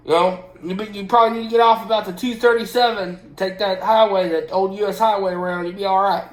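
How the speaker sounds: imitating a Southern trucker warning on the CB. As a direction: call it out like a Southern trucker on the radio. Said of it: "everybody with a C B has a southern accent".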